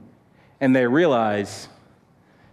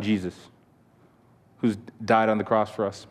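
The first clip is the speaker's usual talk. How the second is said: closing prayer; 2 semitones lower than usual. Real, not acted. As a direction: pray gently on the group's behalf, leaving space between phrases.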